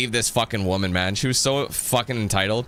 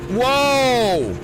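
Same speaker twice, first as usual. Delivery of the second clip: sudden high shout of surprise; loud startled whoa; 10 semitones higher, 5 dB louder than usual. Real, not acted. Gamer caught off guard by the screen, shouting whoa.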